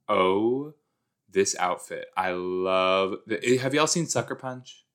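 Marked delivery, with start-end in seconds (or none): none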